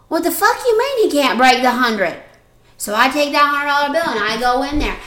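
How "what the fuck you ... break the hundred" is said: loud incredulous outburst, higher and louder; retold outrage. Sister yelling at Sweet Tea about the change. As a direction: blurt it out in disbelief.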